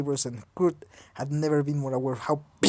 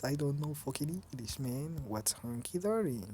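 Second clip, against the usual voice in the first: muttered aside; 11 dB quieter than usual. Real, not acted.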